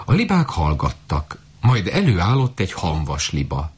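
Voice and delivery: ordinary delivery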